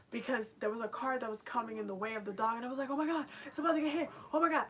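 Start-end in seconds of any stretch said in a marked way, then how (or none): 2.9-4.6 high, loud alarmed oh my God; panicked cry. Retold or imitated. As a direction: cry out in fear for the dog. Panicking as dog nearly hit.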